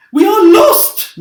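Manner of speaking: very high, loud, slow wail of despair; panicked cry. A narrator's reading. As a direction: shriek it as if all is lost. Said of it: "Miss Spider cried".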